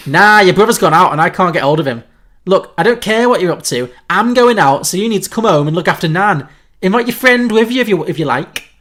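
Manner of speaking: loud, insistent Scouse mum; Scouse accent. Acted. Mum orders Red home to look after Nan, loud insistent Scouse. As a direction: lay down the law in a loud Liverpool accent. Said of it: "so the note is Scouse accent".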